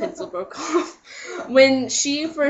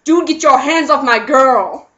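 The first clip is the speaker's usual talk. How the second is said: louder tough-guy warning; protective boyfriend; 4 semitones higher, 9 dB louder than usual. Retold or imitated. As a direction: warn him off loudly. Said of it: "I'm like".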